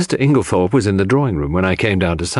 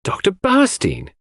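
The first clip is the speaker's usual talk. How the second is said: surprised exclamation, much higher; exclaiming; 11 semitones higher than usual. A narrator's reading. Cynthia surprised at the doctor arriving, exclaiming. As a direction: blurt out the name in surprise. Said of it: "exclaimed Cynthia".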